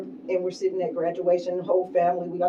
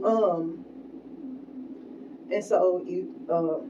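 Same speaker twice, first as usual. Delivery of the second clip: ordinary talk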